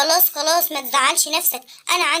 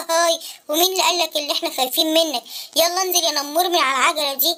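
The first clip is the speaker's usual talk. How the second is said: much lower, threatening beast; menacing tiger voice; 9 semitones lower than usual. Acted. Tigers bully the boy off his bike, low. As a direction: growl the threat down at him.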